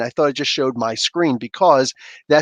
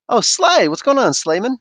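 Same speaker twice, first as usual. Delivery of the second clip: excited greeting; 8 semitones higher, 3 dB louder than usual. Real, not acted. Greets chatter.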